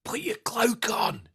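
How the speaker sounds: high, hissed stage whisper; urgent theatrical whisper. Acted. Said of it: "like, stage whisper".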